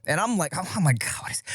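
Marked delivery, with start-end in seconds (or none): 1.0-1.4 exasperated answer under the breath; muttered false start. Retold or imitated. Reenacting answering an interrupting phone call, muttered exasperation. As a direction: start the retold phone answer very softly, then abandon it. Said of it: "I think I answered the phone like this".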